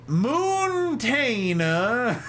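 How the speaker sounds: Moontaina very drawn out, high "MOOOON-TAY-na"; playful mispronunciation. Retold or imitated. Streamer relishing Jack's joke name for Montana, playful mispronunciation. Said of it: "This is good".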